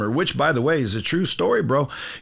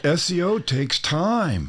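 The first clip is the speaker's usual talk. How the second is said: drawn-out, higher mocking quote; mock sales line; 8 semitones higher, slower than usual. Host teasing guest about his line to a client, mocking. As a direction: tease with a slow, lifted client pitch. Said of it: "gave him the old bullshit".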